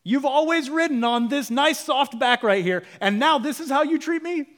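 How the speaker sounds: high, loud, wounded donkey pleading; the donkey scolding its rider, high and loud; hurt talking donkey. Retold or imitated. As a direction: whine it high like a betrayed donkey. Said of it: "He literally says".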